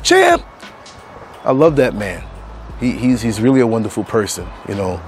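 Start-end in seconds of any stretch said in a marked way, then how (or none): 0.0-0.4 high, loud call of "Champ!". Retold or imitated. Recalling his father-in-law's videos, a loud high call. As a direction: shout it like in the old videos. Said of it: "Remember the videos?".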